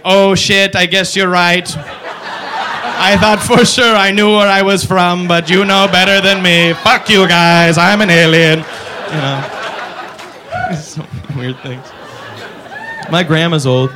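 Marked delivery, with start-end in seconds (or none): 0.0-8.6 slow, loud, drawn-out defeated alien; hammed-up resigned alien. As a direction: concede it slowly like a beaten alien. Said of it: "the alien's like".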